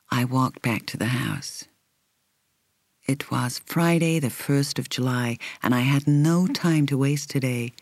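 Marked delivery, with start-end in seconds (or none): none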